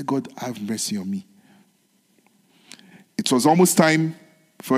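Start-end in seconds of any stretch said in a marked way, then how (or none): 0.0-1.3 soft, lower whispered plea; hushed prayer for mercy; quiet prayer. Shown as a demonstration. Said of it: "quiet, whispered prayer".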